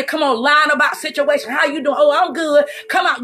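ordinary talk, nothing marked

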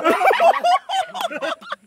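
high-pitched burst of laughter; laughing. Real, not acted. Player gets hit with flour, high laughing.

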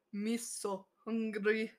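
put-on slow, soft, broken-English wolf voice in a vague Russian-Spanish accent; weird druid accent. Druid pretending to be a hungry wolf, slow made-up accent. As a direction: play a starving wolf begging in a made-up accent. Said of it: "the voice I made for that druid".

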